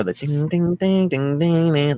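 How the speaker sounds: imitating guitar. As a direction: hum the guitar intro notes. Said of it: "acousticy intro with the guitar".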